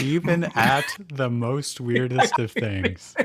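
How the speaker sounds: "Even at the most weirdest of things" read slowly, each word leaned on; mock dramatic emphasis. Shown as a demonstration. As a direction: spell out "weirdest" with heavy deadpan weight. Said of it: "where to put the emphasis".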